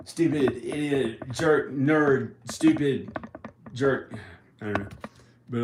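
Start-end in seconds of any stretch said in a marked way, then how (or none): 0.1-4.1 put-on higher, louder childish taunting; mock childish name-calling; kid's name-calling. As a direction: chant insults like a little kid. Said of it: "When I was a little kid, I would say".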